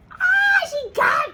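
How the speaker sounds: high, loud startled cry; startled shriek. Real, not acted. Owner shrieks in surprise as her dog jumps on her. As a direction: yelp as the dog leaps up.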